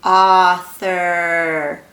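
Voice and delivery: slow, emphatic AUTHOR said as it is air-written; drawn-out author. Shown as a demonstration. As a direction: stretch out "Author" for the class to write it along. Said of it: "Get your writing hands".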